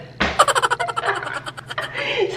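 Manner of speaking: a long high falling giggle; delighted, flattered laugh; long breathy giggle. Real, not acted. Daughter calling her pretty.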